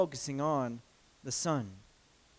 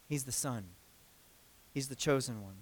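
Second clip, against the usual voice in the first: much lower and softer, settled and sure; quiet reverent answer; hushed answer; 7 semitones lower, 13 dB quieter than usual. Shown as a demonstration. Answers softly and emphatically.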